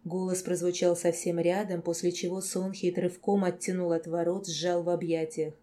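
usual speaking voice

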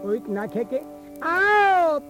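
ordinary delivery, nothing marked

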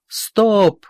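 loud shouted halt; officer shouting. A narrator's reading. Officer shouts stop again, loud. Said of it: "кричит офицер".

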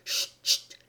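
soft whispered shushing; pleading hush. A narrator's reading. Rabbit begs laughing Alice for silence, whispered hush. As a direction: beg her to be quiet in a whisper. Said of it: "il la supplia de se taire".

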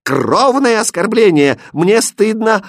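high, loud princess outburst; indignant, high-pitched shouting; outraged cry. A narrator's reading. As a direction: cry out in mortal offence. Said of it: "закричала она".

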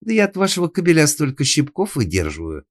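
high, loud outraged protest; heated maid's outburst; indignant. A narrator's reading. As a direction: insist you are the victim. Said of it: "вознегодовала Сандра".

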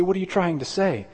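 high mock-questioning listener; imagined objector. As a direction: play the puzzled skeptic. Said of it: "You say".

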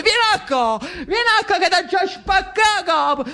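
high, hammed-up threatening yell; high mock threat while running; threatening chase. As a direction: chase him down shouting. Salvatore chases the general.

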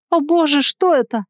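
high, loud startled shriek; frightened squeal. A narrator's reading. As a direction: squeal it out in sudden panic. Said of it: "Ника взвизгнула".

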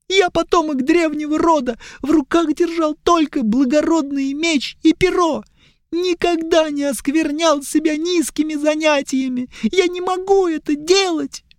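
put-on high, pleading young elf; whiny elf. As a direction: beg high and tearful.

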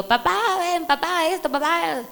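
a lightly voiced daughter begging her father; pleading daughter.

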